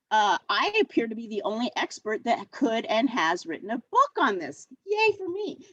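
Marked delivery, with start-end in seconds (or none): 4.8-5.7 high-pitched little self-cheer; mock cheer. Presenter joking about being the only expert, mock cheering. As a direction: playfully celebrate yourself in a high voice.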